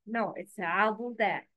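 ordinary talk

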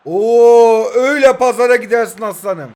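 loud, delighted cheer at the screen; booming gamer celebration; excited shout. Real, not acted. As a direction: yell it out, thrilled with the play. Cheering a good tackle in game.

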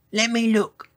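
high-pitched character voice; helpful dinosaur. A narrator's reading. Long-necked dinosaur offering to look, high voice.

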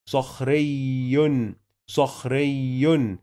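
صخري slowly, the ياء doubled, ending "yun"; slow shadda demo. Shown as a demonstration. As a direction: stretch صخري as "sakhriyyun", leaning on the doubled ياء. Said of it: "عشان كده بيتنطق مرتين". (نطق صخري ببطء مع شدة الياء)

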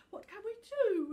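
high, loud sobbing cry; little Tuft sobs to the snow dragon. Acted. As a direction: cry it out through tears. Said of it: "he sobbed".

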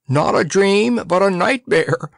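high, choked exclamation; choked horror. A narrator's reading. Forgetful poet seeing a living head, choked horror. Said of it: "choked the forgetful poet".

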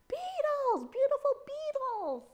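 high, loud greedy delight; loud excited cry. Acted. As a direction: shout it out, thrilled by breakfast. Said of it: "Tuko's loud voice".

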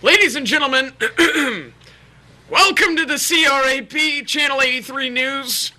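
high, strained mock newsreader voice; hammed-up anchorman. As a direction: play an over-eager eighties anchor. Mock anchor opening newscast.

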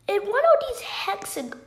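ordinary talk

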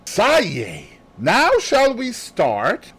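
high, slowed and pleased; Poirot voice. Acted. Poirot sets off with Hastings, pleased and high. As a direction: savour the start.

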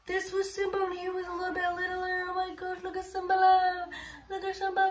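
high-pitched cooing over a pet. Real, not acted. Showing viewers little Simba's photo, high gushing coo.

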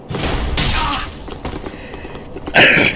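a stifled cough; a held-back cough. Real, not acted. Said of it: "trying to hold down my coughs".

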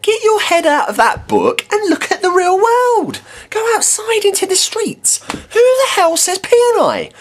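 outraged shouting at a pedant; exasperated ranting shout. Acted. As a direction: shout it up high at an imagined pedant.

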